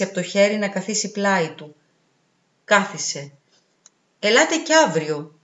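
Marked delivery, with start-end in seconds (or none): none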